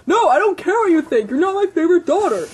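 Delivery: fast, loud, high-pitched outburst; angry yell. Acted. Ken yelling at his daughter, angry. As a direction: yell it down in a rush. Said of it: "yelled Ken in anger".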